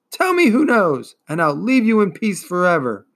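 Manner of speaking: loud, high pleading demand; insistent loud demand. A narrator's reading. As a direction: insist loudly. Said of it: "Atreyu insisted".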